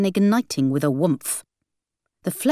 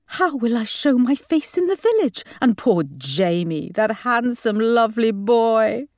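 high wail, slowing into lament; mum's lament; 3 semitones higher than usual. A narrator's reading. Mum lamenting the jilted groom, crying out. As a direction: cry out, then draw out the pity. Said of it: "cried Mum".

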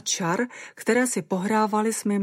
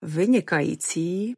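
usual speaking voice

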